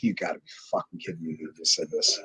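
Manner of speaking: low, quick, hushed aside; muttered disbelief. Retold or imitated.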